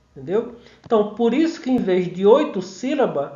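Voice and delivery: ordinary talk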